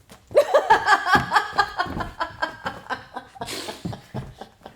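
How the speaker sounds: loud, high burst of laughter; big real laugh. Real, not acted. Host watching Case do a twirl, big loud laugh. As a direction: crack up at the twirl.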